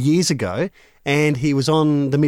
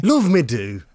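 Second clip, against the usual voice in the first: a hint of an impression on a song title; slower than usual. Panel operator obliging hosts with a sample line, light impression. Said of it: "How would [name] sing a Beatles song".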